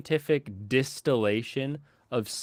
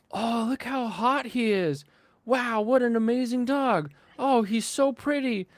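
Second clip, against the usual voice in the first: gushing fans; 10 semitones higher than usual. Retold or imitated. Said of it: "everyone's like".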